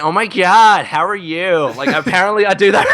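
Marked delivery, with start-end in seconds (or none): 0.0-1.7 put-on high bubbly Valley Girl accent; gushy Valley Girl greeting. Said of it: "an impression that you said was a Valley Girl impression".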